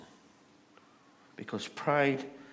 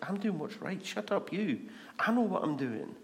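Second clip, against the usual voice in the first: mock defiant king, raised pitch; 6 semitones higher than usual. Proud king telling the prophet to shut up, defiant. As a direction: brush off the critic, lightly acted.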